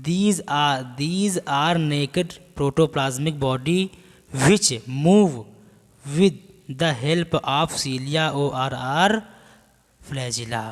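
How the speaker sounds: slow, deliberate reading for note-takers; measured teacher's dictation. Acted. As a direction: dictate in spaced-out chunks. Dictates notes to class.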